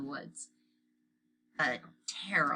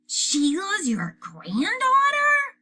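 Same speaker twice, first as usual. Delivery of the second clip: put-on 1 surprised little boy; 8 dB louder, much slower than usual. A narrator's reading. Fudge surprised.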